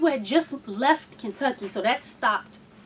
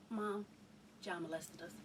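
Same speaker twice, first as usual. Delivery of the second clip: barely audible, much softer; scared whispered confession; hushed confession; 8 semitones lower, 28 dB quieter than usual. Retold or imitated. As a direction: murmur it as if afraid to say it. Confessing abuse to mother.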